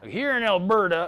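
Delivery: a loud, high mock Texan line; mock Texas accent. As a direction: imitate a local actor faking a Texas accent. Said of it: "put on a Texas accent".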